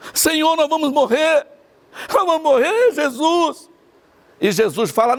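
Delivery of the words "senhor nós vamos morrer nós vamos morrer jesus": higher, pleading cry of doomed men; mock frightened disciples. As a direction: plead like you're about to drown. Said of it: "Eles estavam chorando". (discípulos apavorados clamando a Jesus)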